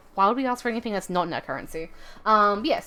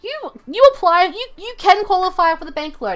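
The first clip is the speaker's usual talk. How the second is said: higher, louder chirpy bank clerk; bank caller voice; 8 semitones higher, 4 dB louder than usual. Retold or imitated. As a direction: play the cheerful bank person on the phone. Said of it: "the bank calls me up and they're like".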